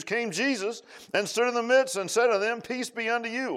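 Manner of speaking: high, loud declaiming of scripture; preached scripture reading. Acted. Preacher reads John twenty aloud to congregation, loud high declaiming. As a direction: proclaim the text to the church.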